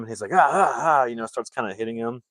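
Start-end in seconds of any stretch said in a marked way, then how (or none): 0.3-1.0 higher, louder punching grunts; mock sparring noises. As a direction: imitate playful jabs with short cries. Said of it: "sparring with him".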